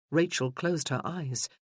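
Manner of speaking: ordinary talk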